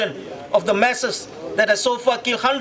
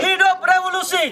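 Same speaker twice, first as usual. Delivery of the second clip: fiery revolutionary speech; 6 semitones higher than usual. Real, not acted. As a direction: chant it out to the crowd. Leads protest chant call.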